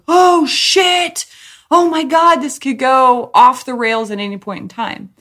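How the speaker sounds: high, loud panic; panicked. Retold or imitated. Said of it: "I'm just like".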